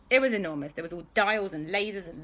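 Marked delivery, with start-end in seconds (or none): none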